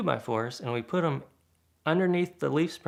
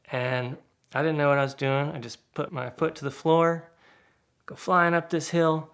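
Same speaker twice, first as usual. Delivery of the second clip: ordinary delivery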